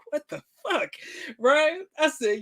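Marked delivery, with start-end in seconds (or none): 0.1-0.9 shocked. Retold or imitated. Shocked at Bible in her bag.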